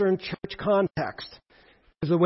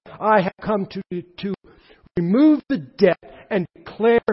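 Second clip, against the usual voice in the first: loud, higher proclamation as Jesus; preaching at full force; 3 semitones higher, 8 dB louder than usual. Acted. Jesus announcing himself to the synagogue, loud preaching. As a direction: proclaim freedom, swelling with each line.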